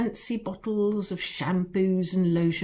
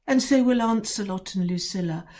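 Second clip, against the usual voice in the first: ordinary delivery, nothing marked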